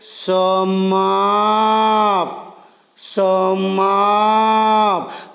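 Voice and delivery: each sound stretched very slowly; slow motion. Shown as a demonstration. Teacher slowing a word so pupils hear the parts. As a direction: drag the word out syllable by syllable. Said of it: "इसी तरह slow motion में बोलेंगे".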